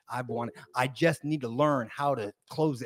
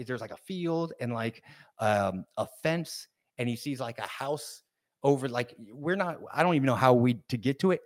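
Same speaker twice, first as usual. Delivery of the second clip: ordinary talk, nothing marked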